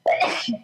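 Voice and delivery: sudden real sneeze; a quick sharp sneeze; sneezing. Real, not acted. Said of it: "sorry, I have to sneeze".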